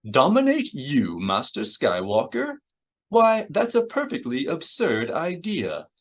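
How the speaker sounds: high-pitched, indignant droid; C three P O. A narrator's reading. Droid dismissing danger to Master Skywalker, indignant high voice. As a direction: scoff in a high, fussy droid voice. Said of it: "C three P O cocked his head".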